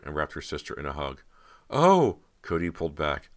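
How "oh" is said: sudden high, loud "Oh!" of surprise; surprised gasp. Acted. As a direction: jolt back, caught off guard. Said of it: "unable to hide her surprise".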